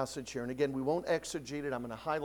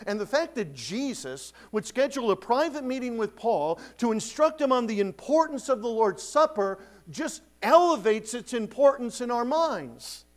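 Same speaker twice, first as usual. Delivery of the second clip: louder, insistent sermon voice; preaching; 7 semitones higher, 6 dB louder than usual. Acted. Pastor exalting the Lord's Supper, louder preaching. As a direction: drive it home with conviction.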